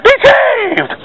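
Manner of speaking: be saved pitched very high, cried out; mock televangelist climax; television preacher. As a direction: soar on be saved like an evangelist on TV. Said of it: "Almost sound like a television preacher there".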